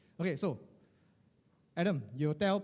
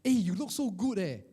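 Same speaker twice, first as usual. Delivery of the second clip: gushing compliment; 5 semitones higher than usual. Acted.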